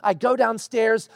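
normal talking voice

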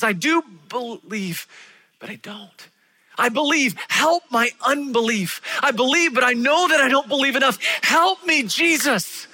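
high, loud desperate plea; crying out. Acted. Father pleading with Jesus for his son, crying out. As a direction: beg for help, voice straining upward. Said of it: "he cries out to Jesus".

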